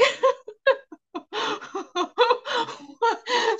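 high, breathless crying laugh; real laughter with tears in it; laughing too hard to speak; helpless laughter. Real, not acted. Recalling the absurd dentist moment.

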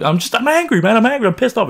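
high-pitched mock drunken anger; retold angry protest. Drunk man answers his friend asking what's wrong, imitated angry protest. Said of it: "I was like".

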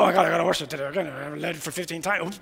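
low, soft muttered complaint; grumbling. Acted. Preacher mimicking a worship leader due to lead today, grumbling. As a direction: mumble it reluctantly to yourself. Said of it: "don't grumble".

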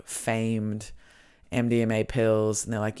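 ordinary talk, nothing marked

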